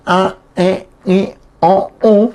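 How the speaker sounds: "A, E, I, O, U" voiced through the nose; teacher demonstrating Guaraní nasal vowels. Shown as a demonstration. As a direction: model each nasal vowel carefully. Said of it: "las cinco vocales nasales".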